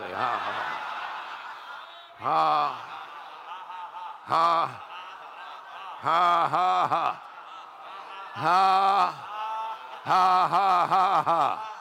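slow, separate "ha" bursts; declamatory forced laughter; deliberate faith laugh. Shown as a demonstration. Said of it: "Now laugh about it".

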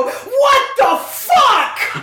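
very high, drawn-out mock outburst; mock freak-out. Friend mimicking Zeke's outburst in the cinema, comically panicked. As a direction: wail it as a terrified moviegoer would. Imitates seatmate's outburst at film.

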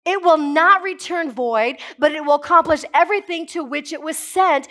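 full-voiced sermon build; fervent preaching. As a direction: preach it out, rising with conviction. Preaching at full force on binding.

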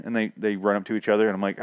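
ordinary talk, nothing marked

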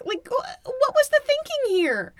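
high, loud disbelieving outburst; exasperated. Real, not acted. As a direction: squeak out total disbelief. Exasperated at film characters.

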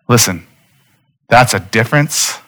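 ordinary delivery

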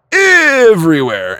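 emphatic everywhere, pulled long and high; stretched everywhere. Real, not acted.

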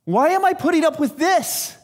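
high, loud outraged question; shocked disbelief. Retold or imitated. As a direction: recoil at what you just found. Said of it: "we should go".

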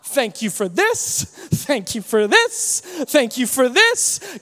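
drawn-out, slow "thank you for this"; deliberate slow grateful prayer. Shown as a demonstration. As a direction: savor each thank you slowly. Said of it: "which actually means".

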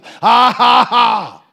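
high, shouted mock laugh; Brother Hagin laughing. Preacher imitating a man answering the devil's taunt, loud forced laughter. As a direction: cut in with a loud, defiant ha-ha-ha. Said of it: "But he laughed".

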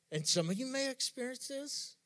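usual speaking voice

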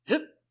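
a comic little leap of a cry; mock hop yelp. Reek leaping off the boat, sudden comic yelp.

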